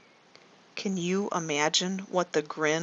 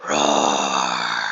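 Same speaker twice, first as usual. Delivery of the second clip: deep, long, loud roaring growl; a low, booming lion roar for the children; big lion roar; 7 dB louder than usual. Shown as a demonstration. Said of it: "The lion roared again".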